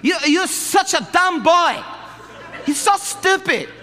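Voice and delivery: high, loud, slow mock yelling; yelling mother. Korean mother yelling at her son, mock yelling. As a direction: berate him with a laugh in it. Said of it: "yelling at him in front".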